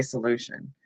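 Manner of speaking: a low, hushed "solution", savoring the sound; admiring imitation. Fan quoting the friend's favorite word, admiring imitation. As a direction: softly echo "solution" as the friend says it. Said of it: "I love the way you say".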